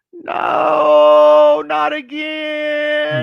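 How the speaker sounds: high, drawn-out mock cry; loud hammed-up wail; inner wail of dread. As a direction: howl it in comic despair, stretching the words. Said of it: "that's what I'm thinking".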